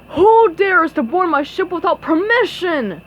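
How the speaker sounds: loud pirate roar. A narrator's reading. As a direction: roar it out like a ghost captain. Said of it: "he roared".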